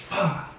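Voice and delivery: high, explosive "pah"; sudden mock impact noise; imitated door bang. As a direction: burst out a slamming sound effect. Imitates door hitting wall.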